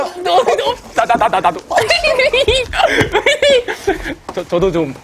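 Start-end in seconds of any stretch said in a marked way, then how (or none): 0.2-1.6 laughing plea. Real, not acted. Begging for handshake, laughing. 1.7-4.3 breathless high laugh; high squealing laugh. Real, not acted. Laughing hard.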